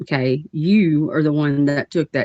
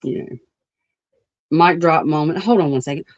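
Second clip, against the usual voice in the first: ordinary delivery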